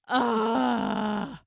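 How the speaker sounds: loud, drawn-out groan; exasperated groan. Real, not acted. Groaning at the obvious answer.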